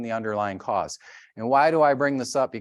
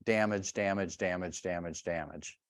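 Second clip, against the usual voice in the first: each damage softer until nearly gone; fading repetition; 4 semitones lower, 8 dB quieter than usual. Acted. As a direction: let the word die away bit by bit. Repeating damage fading away.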